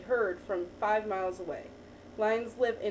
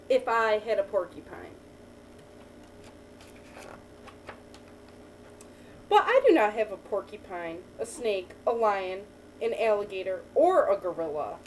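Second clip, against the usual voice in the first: put-on reading to a child; 4 dB louder than usual. Teacher reading a picture book to a child, lively storytelling. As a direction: read the picture book to the child, lifting each new animal.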